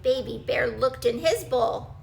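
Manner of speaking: usual speaking voice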